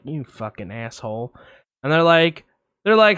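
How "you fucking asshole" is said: low curse under the breath; resentful mutter. Retold or imitated. Watching the cat lick its owner, low resentful mutter. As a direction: mutter it so the owners won't hear.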